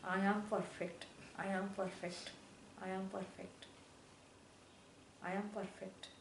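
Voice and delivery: quiet, earnest self-affirmation; soft repeated affirmation; serious affirmation. Real, not acted. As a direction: say it to yourself and mean it. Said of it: "I repeat that seriously".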